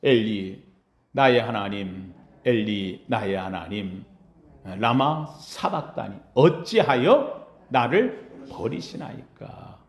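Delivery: low, slow, pleading cry; anguished plea. Retold or imitated. Pastor voicing Jesus asking God why, low and pleading. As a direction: pray it low and broken. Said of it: "하고 외치는 이 한마디".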